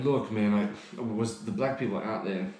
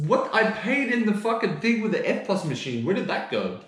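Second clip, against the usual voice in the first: high, loud exasperated protest; outraged; 10 semitones higher, 5 dB louder than usual. Real, not acted. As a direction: yell it in disbelief. Ranting at company.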